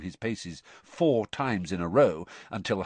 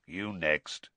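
low growled order; 4 semitones lower, 4 dB quieter than usual. A narrator's reading. Teacher picking his next victim in class, low growl. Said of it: "Moody growled".

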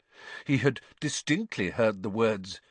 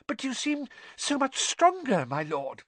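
high, pleading flattery dropping at the end; squeaky servant. A narrator's reading. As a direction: coax the master in a high, anxious voice.

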